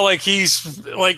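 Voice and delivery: ordinary talk, nothing marked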